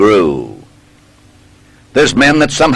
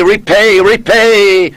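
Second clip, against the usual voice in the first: high, loud cry of vengeance; an urgent, high call to repay; ringing cry; 10 semitones higher, 4 dB louder than usual. A narrator's reading. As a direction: cry it out like a burst flood. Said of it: "it seemed to say".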